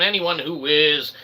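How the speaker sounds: ordinary delivery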